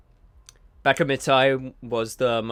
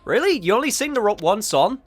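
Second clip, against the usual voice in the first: surprised; 9 semitones higher, 6 dB louder than usual. Real, not acted. Surprised at one song option.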